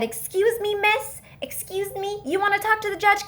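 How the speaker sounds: high, lightly mimicked official; bailiff calling after someone. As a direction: imitate a condescending summons. Imitating bailiff calling after her.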